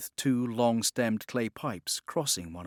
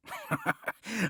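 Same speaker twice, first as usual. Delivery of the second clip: sudden scornful laugh; a short mocking laugh at the Templar; abrupt scornful laugh, higher than his speech; 10 semitones higher than usual. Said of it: "Walpole laughed".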